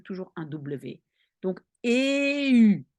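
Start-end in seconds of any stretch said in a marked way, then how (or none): 1.8-2.8 "eu" raised, a long e gliding into u; sound-blending demo. Shown as a demonstration. Teacher analyses the sound for learners, drawn-out demo. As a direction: hold "eu", moving from e to u. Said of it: "Je dis d'abord le e".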